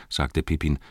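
usual speaking voice

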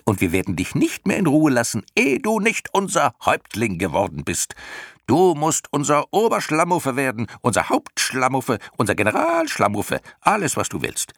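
high, insistent creature voice; screeching demand. A narrator's reading. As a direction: badger in a high clownish screech. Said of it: "kreischten die Schlamuffen". (hoch und drängend gekreischt)

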